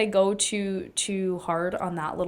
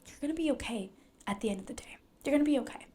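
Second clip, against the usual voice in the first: softly whispered comfort; soft reassurance; 4 semitones higher, 4 dB quieter, faster than usual. Real, not acted. Comforting the viewer in a soft whisper.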